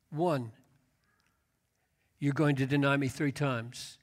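quiet, fading Jesus. Acted. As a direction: let it sink to a hush, like a tender rebuke. Jesus gently counting Peter's failures.

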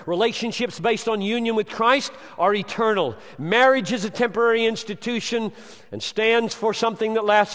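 loud, high preaching at full force; thundering sermon voice; fiery preaching. Acted. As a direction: preach it hard to the congregation.

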